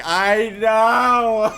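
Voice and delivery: high, loud laughing exclamation; delighted laughing shout. Real, not acted. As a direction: burst out laughing as you agree. Laughing reaction to chat comment.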